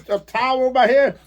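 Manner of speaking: normal talking voice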